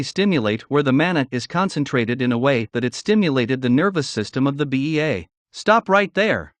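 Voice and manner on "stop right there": slow, higher commanding shout; loud shout. A narrator's reading. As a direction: bark it out to stop him. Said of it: "I heard a loud shout".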